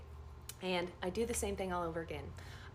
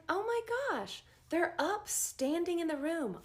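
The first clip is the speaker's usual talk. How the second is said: surprised; 9 semitones higher than usual. Retold or imitated. Dream, surprised to see patient standing.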